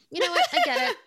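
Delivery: high, loud laughing burst; laughing at her own joke. Real, not acted. Laughs at the crabs on Fiji idea, high and loud.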